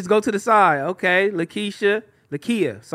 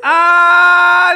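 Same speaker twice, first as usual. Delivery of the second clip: long, high, loud "ah"; delighted shout; 7 semitones higher, 7 dB louder than usual. Real, not acted. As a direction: burst out in a drawn-out yell. Laughing at the retold cheering.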